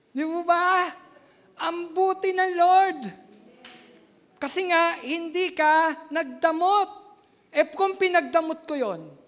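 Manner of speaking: loud, high preaching swell; fervent preaching. Real, not acted. Pastor praising the Lord to the congregation, loud and high. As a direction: lift the congregation with it.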